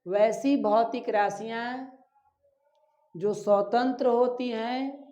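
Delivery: slow, deliberate dictation pace. Shown as a demonstration. Teacher repeating the definition slowly for notes. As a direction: dictate it slowly so they can write. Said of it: "एक बार और repeat करते हैं".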